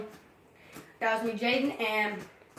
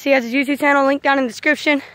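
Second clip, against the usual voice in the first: ordinary talk, nothing marked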